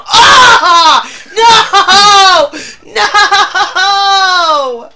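loud, long quavering wails; horrified wail. Real, not acted. Streamer picturing a spider nest, horrified wail. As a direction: scream it out in dread.

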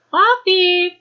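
high, loud call across the room; calling the kitten. Real, not acted. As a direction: call out sharply to a pet.

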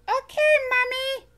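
high, bright little furry monster voice, eager; squeaky childlike monster, proud; Elmo voice. Acted. Elmo answering mother.

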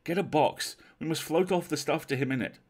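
loud, high command; impassioned, insistent order; urgent sympathy. Acted. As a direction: urge a shipmate to help at once. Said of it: "over which predominated a vast sympathy".

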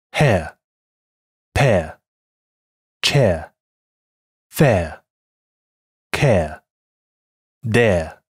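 each word hair, pear, chair drawn out slowly with a clean air glide; slow word drill. Shown as a demonstration. Teacher reads the practice words for students to copy. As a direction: read the practice list slowly, one word at a time. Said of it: "再来一遍".

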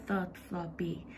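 usual speaking voice

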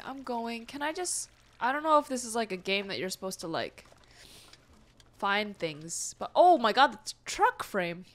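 ordinary talk, nothing marked

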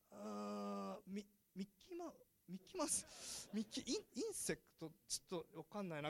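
imitating a confused learner sounding out a word; confused student. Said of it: "みたいになっちゃうわけですよ".

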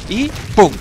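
loud, high mock boom; explosion sound. Real, not acted. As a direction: shout the boom like a blast. Explosion goes off.

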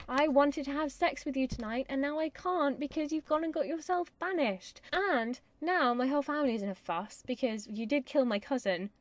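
angry modern brat telling him off; snotty, arrogant Juliet. Acted. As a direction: whine indignantly at your banished lover. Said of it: "she's snotty and arrogant to me".

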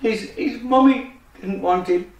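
ordinary delivery

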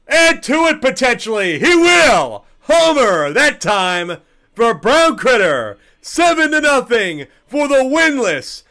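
loud, high, drawn-out home run call. Acted.

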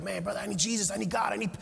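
panicked plea. Retold or imitated. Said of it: "I'm hysterical".

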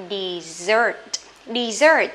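Dessert with the second syllable stressed and drawn out. Shown as a demonstration. Teacher models Dessert for students, second syllable stressed. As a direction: say Dessert leaning on the last syllable, de-SSERT. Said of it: "Âm hai nhá".

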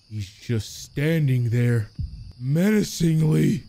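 slow, drawn-out cartoon voice; slow mock-menacing delivery; cartoon quote. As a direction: stretch each word out in a put-on ominous voice. Quotes a SpongeBob cartoon line.

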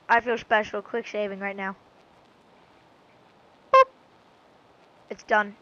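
usual speaking voice

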